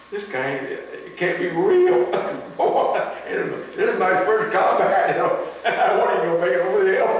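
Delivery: can't believe it, laughing hard; real laughter breaking into the telling; laughing disbelief. Real, not acted. Laughs heard, disbelief, louder.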